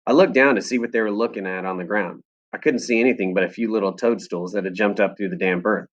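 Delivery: ordinary delivery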